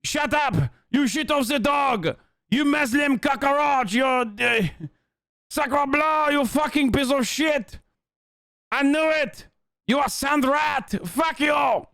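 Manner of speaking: high-pitched, hammed-up French accent rant; mock French accent, furious and high; outraged French accent. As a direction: shout insults as an irate caricature Frenchman, thick French accent. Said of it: "When I shit on the French, they're like".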